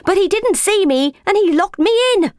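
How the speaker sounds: high, aggrieved talking-cat voice; Ginger. A narrator's reading. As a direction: complain as a wronged cat, pitched high. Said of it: "meowed Ginger".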